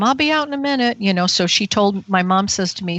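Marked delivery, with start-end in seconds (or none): 0.0-1.0 high-pitched mock call from another room; raised, singsong retold holler; retold yell. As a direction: call out as if from the back room, without real volume. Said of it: "she yelled from the back room".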